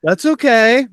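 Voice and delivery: loud, high mock reassurance; imitated forgiveness. Podcaster imitates Pritchard accepting Tom's apology, loud high mock reassurance. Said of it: "And Pritchard's like".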